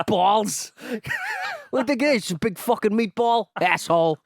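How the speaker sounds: high, loud mock New Jersey wise guy; New Jersey accent. Co-host parodying the American character, loud New Jersey accent. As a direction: hurl insults in a cartoon New Jersey accent. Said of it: "one of the characters was from New Jersey".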